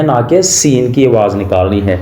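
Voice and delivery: usual speaking voice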